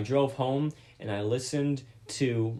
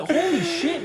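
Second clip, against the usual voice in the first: laughing disbelief; 5 dB louder, slower than usual. Retold or imitated. Retold disbelief at register.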